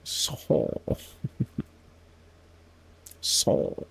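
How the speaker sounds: Sol breathed out nasally with a laugh; giggling at own French; whispered nasal try. Shown as a demonstration. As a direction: mutter Sol through the nose. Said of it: "I'm not very good with nasal sounds".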